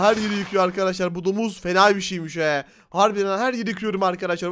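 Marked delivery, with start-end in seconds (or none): none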